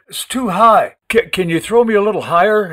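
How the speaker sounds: panting plea from the trunk; gasping. A narrator's reading. Breathless boy calling down to Sonny, gasping plea. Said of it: "he gasped".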